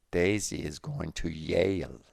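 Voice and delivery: "Yale" said with a Y sound instead of the J of jail. Acted. Rogelio repeating himself to Gwen, "Yale" meaning jail. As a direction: stress Daisy, a firm falling "Yale" meaning jail. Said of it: "hard for native Latin speakers to pronounce the J".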